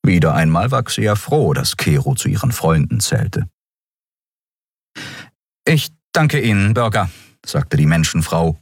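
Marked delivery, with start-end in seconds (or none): 5.7-7.1 higher, struggling to stay composed; composed thanks. A narrator's reading. Shaken guard thanking Keru, trying to stay composed. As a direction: thank him while regaining composure. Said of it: "um Fassung bemüht".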